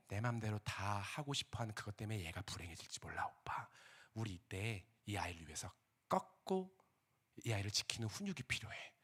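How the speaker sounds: calm firm reply, dropping to a whisper; hushed firm wife. Retold or imitated. Wife quietly insisting on discipline to husband, whispering.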